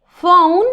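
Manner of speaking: "phone" said clearly with an F sound at the start; teacher models "phone" with an F sound. Shown as a demonstration. Teacher modelling "phone" for the class, F sound clearly. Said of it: "P H is pronounced like an F".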